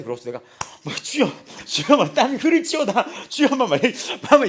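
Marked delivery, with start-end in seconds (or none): 1.0-3.3 high, loud mock-desperate cry of Lord; hammed-up fervent plea, much higher and louder; crying out to God. Young man begging God to make him sweat, loud mock-fervent cry. 3.3-4.5 crying out to God. Retold or imitated. Retold desperate prayer.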